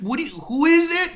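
high-pitched yelling into the phone. Retold or imitated. Shoe Nice answering an unknown caller, high yelling. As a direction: demand it over background noise. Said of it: "it's really loud where he is".

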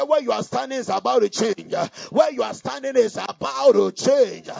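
loud, half-sung proclamation; prophetic declaration. Real, not acted. Preacher prophesying to congregation, loud half-sung. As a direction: sing out the prophecy. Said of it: "I said".

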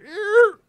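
high, loud screech of brakes; imitated tyre screech. Man telling of the cop suddenly stopping, screeched. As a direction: mimic a car screeching to a stop. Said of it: "all of a sudden it's".

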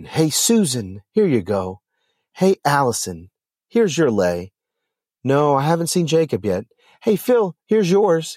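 high, lilting hellos to one guest after another; cheery greeting. A narrator's reading. Sterling hands leis to arriving guests, greeting them cheerily. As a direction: call out each name with put-on cheer.

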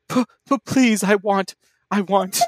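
high, halting hobbit plea; stammered pleading. A narrator's reading. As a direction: trip over the first sound, begging. Hobbit begging, stammering, in fanfic.

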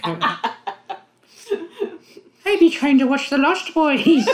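high, prissy mock-British little lord; Little Lord Fauntleroy. Mocking the actor's accent as a little lord, hammed-up British. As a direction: ham up a British child lord. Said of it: "Little Lord Fauntleroy".

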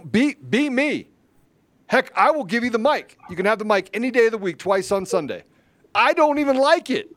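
loud, high preacher-style exhortation; rousing call to action. Real, not acted. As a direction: fire up the listeners to step in. Rallying call to join fight.

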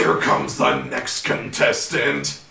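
deep, slow, loud announcer voice; hammed-up, drawn-out low announcement; mock announcer. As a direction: boom it like a contest host. Joins mock announcer joke.

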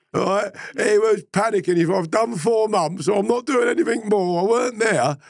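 very high, loud, squeezed out through laughter; helpless laughing speech. Real, not acted. Retelling his panicking mate's protest, helpless with laughter. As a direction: barely get the words out for laughing.

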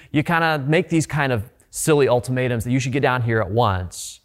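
normal talking voice

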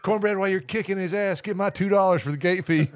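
mock demand. Acting an enemy yelling to cornbread.

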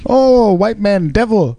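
put-on slow, high caricature Chinese accent; hammed-up Chinese accent; mock Chinese accent. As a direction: drag it out in a fake Chinese accent. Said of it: "makes it sound like Confucius".

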